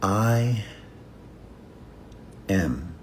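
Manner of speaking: unhurried, inward statement; slow, quiet affirmation. Shown as a demonstration. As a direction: say each word slowly on its own. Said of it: "slowly say".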